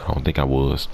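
ordinary delivery

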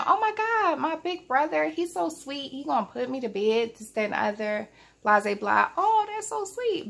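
put-on high, gushing child's delight; starry-eyed little sister. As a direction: gush like a six-year-old won over. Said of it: "I'm thinking".

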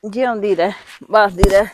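loud, fond blessing to her daughter. Acted. Mother loudly blesses daughter who touches her feet. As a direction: call out the blessing as she bows.

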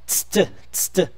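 tst as bare consonants, almost voiceless; soft, crisp tst demonstrated as T-S-T; isolated consonant cluster. Shown as a demonstration. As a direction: say tst twice with a gap between. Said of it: "Você tem que colocar o T S T".

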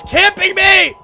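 high, loud protest; outraged yelling. Real, not acted. Gamer to the enemy camping him, outraged yelling. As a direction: yell it in outrage at being cornered. Complains of being camped.

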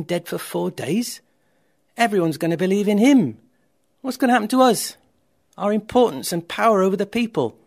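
loud, high, agitated questions; alarmed outrage. A narrator's reading. Pharisees meet about the miracle, alarmed and indignant. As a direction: build indignant panic about losing power.

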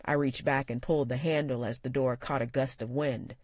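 usual speaking voice

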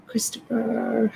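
soft breathy whisper of the name. Real, not acted. Greets viewer in a whisper.

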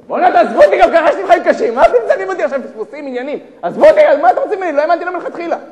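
high, very loud acted protest; indignant outburst. Man suspecting a hidden-camera prank, indignant shouting. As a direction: rail at them as if pranked.

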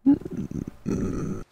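soft, high tearful whimper; quiet, choked-up whimpering; crying. Real, not acted. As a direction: squeeze out a tiny weepy voice.

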